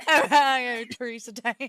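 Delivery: southern Ohio, Teresa Tan broken by laughter; laughing southern accent. Pretend. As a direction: push through the southern line while cracking up. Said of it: "with a southern accent".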